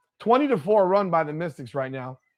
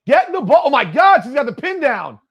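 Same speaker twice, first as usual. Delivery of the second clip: higher and louder, thrilled by the play; excited call; 8 semitones higher, 7 dB louder than usual. Real, not acted. Fan watching the game, calling the pin-down, excited. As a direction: jump up as the pin comes down. Big play unfolding live.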